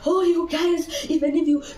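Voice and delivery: high, frantic retold rant at the doctors; mad woman ranting. Mother in labour rants at lying doctors, frantic and high. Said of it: "I was talking like a mad woman".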